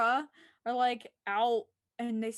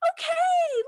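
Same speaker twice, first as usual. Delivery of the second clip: much higher, louder fed-up okay; exasperated okay; 8 dB louder than usual. Retold or imitated. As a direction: throw up your hands at it. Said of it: "I'm like".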